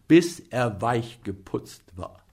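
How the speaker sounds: usual speaking voice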